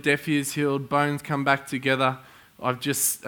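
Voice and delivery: normal talking voice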